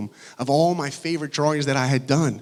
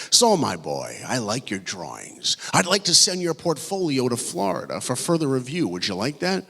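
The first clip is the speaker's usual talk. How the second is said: put-on old animator's voice. Veteran animator offering to send a student's portfolio on, old man's voice. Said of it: "He had the coolest voice".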